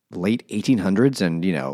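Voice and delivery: ordinary delivery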